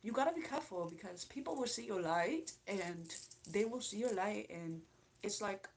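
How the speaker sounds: imitated London accent; Charlie. London girl warning the streamer to be careful, imitated London accent. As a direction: give gentle advice as a London girl, accent put on. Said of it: "she'd be like".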